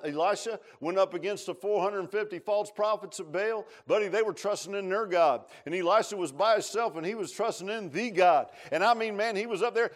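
usual speaking voice

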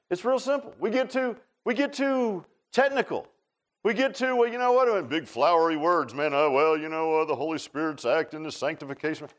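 high, loud preacher's push; full-force preaching. Acted. Pastor urging the congregation, preaching at full force. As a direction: drive the point home to the pews.

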